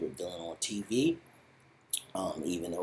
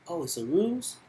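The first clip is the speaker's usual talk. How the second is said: a much higher, louder sarcastic "oh, it's a ruse?"; mock surprise; 10 semitones higher, 6 dB louder than usual. Dealer mocking the accountant's excuse before shooting, high, loud mock surprise. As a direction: feign shock, then menace. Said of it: "He's like".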